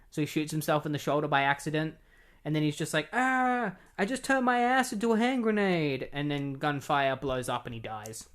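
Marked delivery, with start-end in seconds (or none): 3.1-6.1 high comic cry of panic; doomed superhero whining in a high voice; mock pained wail. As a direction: wail it in hammed-up agony. Doomed hero wailing, retold.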